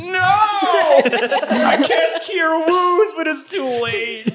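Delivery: high mock-anguished cry. Cordy crushing a bee, voiced in a high laughing wail. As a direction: wail that it's too late, in a silly high voice. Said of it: "And she's like".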